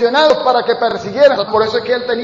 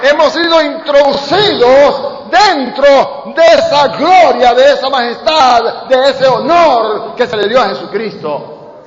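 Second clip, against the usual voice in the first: loud, slow, drawn-out preaching cry; fiery preaching; 6 semitones higher, 8 dB louder, slower than usual. Acted. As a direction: proclaim it at full force to the church. Preaching at full force. (predicación con fuerza, gritando despacio)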